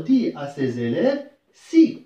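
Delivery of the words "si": "Si" hit high and loud; emphatic si. Shown as a demonstration. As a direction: punch the word "Si" high and loud. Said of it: "أداة الربط هنا عندي شكون هي".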